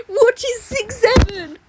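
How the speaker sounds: high, slow baffled voice; hammed-up puzzled kid; mock confused kid. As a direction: play a clueless kid.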